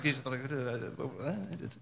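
mock flustered sputter, trailing into half-words; sputtering disbelief. Stunned disciples trying to answer Jesus, sputtering. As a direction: stammer softly, words failing to form.

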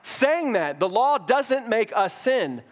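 high, slow, each word pressed; emphatic insistence. Pretend. Paul insisting to the Romans the law is not sin, slow and emphatic. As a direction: insist firmly so nobody mistakes it. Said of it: "He wants them to know right away".

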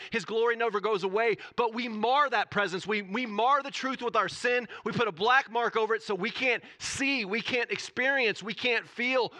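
loud, high-pitched preaching at full force; impassioned preaching. Real, not acted. Preacher urging the church to lay sin down, impassioned. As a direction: pour it out with rising urgency.

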